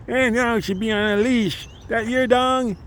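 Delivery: put-on high, scolding old-lady voice; mimicking old lady. Mocking the old lady who scolded him about the leash, high and scolding. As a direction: pitch up high like a nagging old woman. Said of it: "some old ass lady was like".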